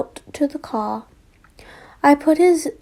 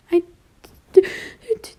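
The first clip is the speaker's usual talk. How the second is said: tearful broken stutter; crying stutter; 6 semitones higher, 5 dB louder than usual. A narrator's reading. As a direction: stammer through tears. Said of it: "he cried".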